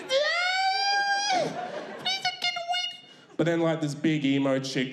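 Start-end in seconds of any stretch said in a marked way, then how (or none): 0.0-3.0 high, slow hammed-up pleading; a melodramatic teen begging; mock wail. Girl to coworker, high mock-tearful plea. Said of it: "She goes".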